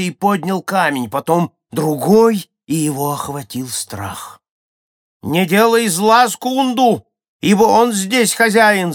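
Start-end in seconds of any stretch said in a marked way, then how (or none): 5.2-8.9 high, loud warning cry; woman shouting. A narrator's reading. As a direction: shout a warning across the crowd. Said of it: "закричала ему одна женщина". (громкий высокий женский крик)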